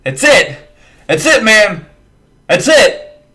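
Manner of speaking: high, bright cheering with a laugh in it; giddy excitement. Real, not acted. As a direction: celebrate like you just won. Euphoric opening cheers to camera.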